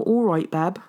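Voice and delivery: second go at a bad Midlands greeting; Midlands accent. Retrying a mock Midlands greeting to Danny, falling. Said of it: "my really terrible, uh, Midlands accent".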